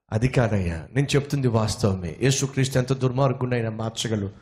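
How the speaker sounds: calm him down gently; gentle, calm reply. Acted. The preacher gently answers the angry man. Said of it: "చాలా సౌమ్యంగా".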